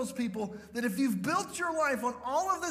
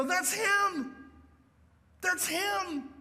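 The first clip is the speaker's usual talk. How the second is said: joyful recognition; 7 semitones higher, much slower than usual. Pretend. Believers seeing Jesus, crying out with joy. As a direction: shout it out in joyful awe.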